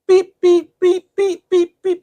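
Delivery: high imitated monitor beeps; imitating a hospital heart monitor; monitor beeping. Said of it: "It's my heart rate".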